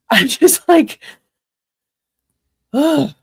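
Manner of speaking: laughing, then a slow exasperated ugh; annoyed groan. Real, not acted. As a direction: let out a fed-up, laughing groan. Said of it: "oh God, I'm so annoyed".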